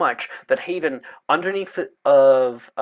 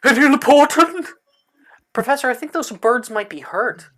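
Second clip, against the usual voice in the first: high, loud frantic cry; dotty professor yelling about birds; Trelawney screaming; 11 semitones higher, 7 dB louder than usual. Acted. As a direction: shriek it out in a panic. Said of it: "her scream out".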